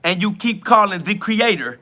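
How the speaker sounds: mocking a preachy warning, pitched high; mock preacher. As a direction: parody the self-righteous commenter. Mock-reading a scolding comment.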